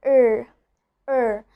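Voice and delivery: er said with the tongue rolled for a full R; strong American R. Shown as a demonstration. Teacher demonstrating the American R. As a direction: hold a strong rhotic er. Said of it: "we pronounce letter R as".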